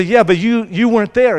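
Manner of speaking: high, loud accusing cry; indignant protest. Retold or imitated. Believer protests to God, loud and indignant. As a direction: throw the complaint back at God.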